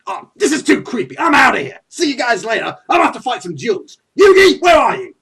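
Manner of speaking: loud, high outburst building to a yell; exasperated exit. Acted. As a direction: storm off, calling out for a friend. Acted rival storms off, calling Yugi.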